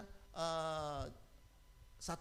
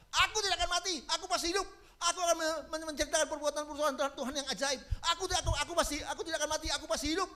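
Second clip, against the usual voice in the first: fervent proclamation; 11 semitones higher, 5 dB louder, much faster than usual. Acted. Repeating verse with patient.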